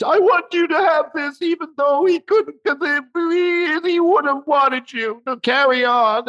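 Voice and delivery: high mock weeping, slowing at the end; imitating tearful widow. Widow urging the mourners on, mock tearful. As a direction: wail it high, as a crying widow. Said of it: "she's crying".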